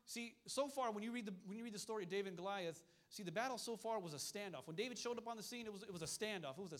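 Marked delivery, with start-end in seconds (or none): none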